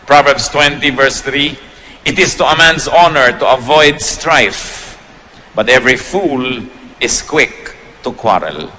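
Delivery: slow, measured scripture read; preacher reading a Bible verse to the congregation, slow and solemn. Acted. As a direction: read the verse slowly and deliberately. Reads scripture slowly.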